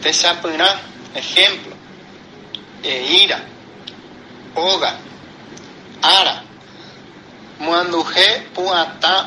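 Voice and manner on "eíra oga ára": "Eíra", "Oga", "Ára" with force on í, O and Á; stressed marked syllable. Shown as a demonstration. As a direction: lean on the accented vowel: í in "Eíra", O in "Oga", first Á in "Ára". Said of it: "Se pronuncia con más fuerza la sílaba". ("Eíra", "Oga", "Ára" con más fuerza en la sílaba acentuada: í, O, Á)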